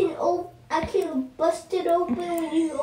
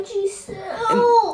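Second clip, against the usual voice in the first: louder, drawn-out crying call of her name; pleading whine; 5 dB louder, slower than usual. Real, not acted. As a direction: stretch her name in a tearful plea. Brother pleading, calls her name.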